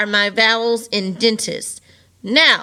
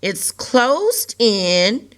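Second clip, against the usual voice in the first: ordinary talk, nothing marked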